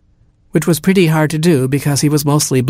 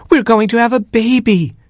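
much higher, overjoyed announcement; joyful cry; 11 semitones higher than usual. A narrator's reading. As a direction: burst out with the happy news. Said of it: "she cried".

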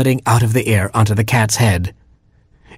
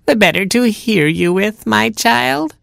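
high, sugary fake granny; cat posing as grandma; 12 semitones higher, slower than usual. Cat reassures Robin about big ears, high mock-granny voice. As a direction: coax the child in a high granny voice.

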